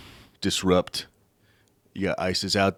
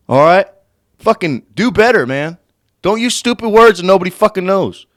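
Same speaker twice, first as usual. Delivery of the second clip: loud, higher, heated scolding; angry outburst; 9 semitones higher, 9 dB louder than usual. Real, not acted. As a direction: scold the journalist, full annoyance. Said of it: "angers me inside".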